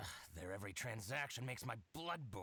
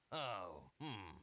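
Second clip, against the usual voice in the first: ordinary talk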